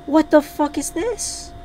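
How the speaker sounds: high, drawn-out incredulous question; real angry disbelief. Real, not acted. Player still staring at the damage, angry disbelief. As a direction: stretch it out high, outraged. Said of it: "actually mad".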